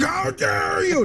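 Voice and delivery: high, stretched-out indignant shriek; way up high and slow, hammed-up outrage; mock outraged shriek. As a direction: play the scandalized host, all pearl-clutching. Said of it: "they're like".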